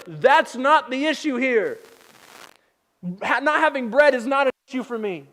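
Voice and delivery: exasperated. Retold or imitated. Jesus correcting the disciples about bread, exasperated.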